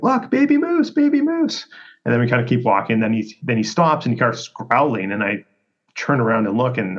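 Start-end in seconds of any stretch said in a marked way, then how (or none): 0.0-1.7 high-pitched mock yell; imitating an excited holler down the hill; retold yell. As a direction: call out to the kids in a high voice, without real volume. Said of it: "I'm, like, yelling to my kids".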